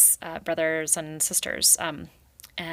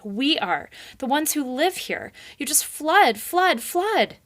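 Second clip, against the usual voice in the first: rising higher and louder into a shout; furious rant; 8 semitones higher, 6 dB louder than usual. Acted. Rai raving at the beaver. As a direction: hammer each flood harder.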